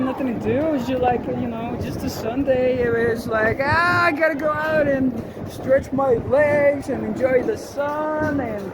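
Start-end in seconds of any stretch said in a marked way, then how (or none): 3.6-8.4 higher, drawn-out acted yearning; lightly hammed-up, slow longing; mock longing. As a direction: play up wanting to get outside. Quotes self longing to get out.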